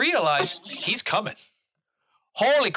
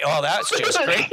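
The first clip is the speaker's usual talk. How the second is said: ordinary talk, nothing marked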